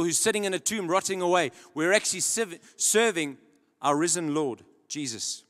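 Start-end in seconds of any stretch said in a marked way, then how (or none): none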